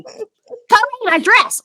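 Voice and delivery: very high mock holler for help; high-pitched, hammed-up call; imitated yell. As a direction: mimic her yelling at him. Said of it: "we imitated her like that".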